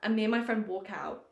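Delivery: usual speaking voice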